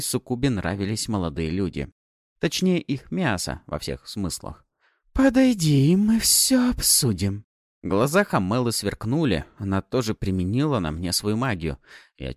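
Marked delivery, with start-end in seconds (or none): 5.1-7.4 high, slow, luring; seductive demon. Acted. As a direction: beckon him closer. Succubus beckons.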